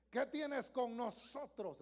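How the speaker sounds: loud, slow, high demon question; loud slow scandalized demon cry; half scandalized. Acted. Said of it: "lo dijeron así medio escandaloso".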